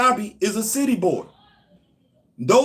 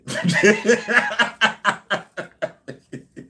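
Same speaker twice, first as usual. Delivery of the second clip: big laugh; 3 semitones higher than usual. Real, not acted. Laughs at own claim.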